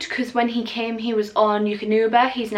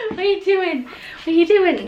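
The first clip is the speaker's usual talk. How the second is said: high puppy talk; 8 semitones higher than usual. Real, not acted. Owner teasing playful puppy.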